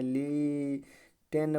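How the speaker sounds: लिटेन drawn out slowly as "leee-ten"; common misreading. Shown as a demonstration. Teacher showing how people misread it, drawn-out misreading. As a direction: stretch the first syllable of लिटेन, then a clear t. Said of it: "ऐसे पढ़ते हैं".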